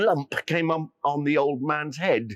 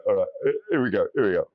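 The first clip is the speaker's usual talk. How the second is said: ordinary delivery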